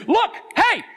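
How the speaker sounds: high-pitched doggy talk. Retold or imitated. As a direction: hype up a dog to look at something. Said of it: "that's the language here".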